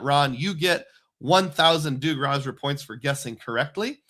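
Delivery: ordinary talk, nothing marked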